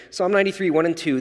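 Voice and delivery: usual speaking voice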